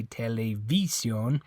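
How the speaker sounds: TELEVISIÓN wrongly as te-le-VEE-syon; TELEVISIÓN with stress moved to VI; stress on third syllable. Shown as a demonstration. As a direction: hit the VI of TELEVISIÓN. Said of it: "It's not this I".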